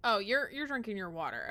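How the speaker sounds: ordinary delivery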